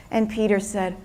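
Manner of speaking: ordinary delivery, nothing marked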